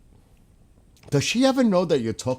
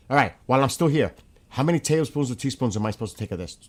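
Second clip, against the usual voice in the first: fast and a bit louder, racing the dropping stream; rushed, hurried question; 3 semitones higher, 4 dB louder, faster than usual. Real, not acted. Streamer rushing to ask Skywalker before the stream drops, hurried and fast. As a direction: rattle it off quickly before the connection dies. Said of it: "Hurry up".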